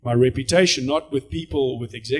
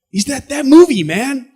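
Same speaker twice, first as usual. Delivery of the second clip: high, loud, eager question; excited fan; 10 semitones higher, 8 dB louder, slower than usual. Retold or imitated. Teller pestering the actor, high and loud. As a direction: pester him with delight. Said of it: "super excited".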